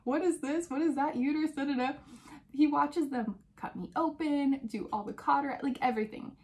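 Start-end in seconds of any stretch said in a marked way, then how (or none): 0.0-1.9 higher, eager rapid-fire questions; husband quizzing the doctors mid-surgery, higher and eager; curious husband. Retold or imitated. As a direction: play the nosy husband peering over the curtain. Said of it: "asking questions".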